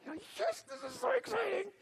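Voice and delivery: high, squeaky contained squeal; silently screaming for joy, voice squeezed tiny; inner excitement. Retold or imitated. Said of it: "but I'm inside, I'm like".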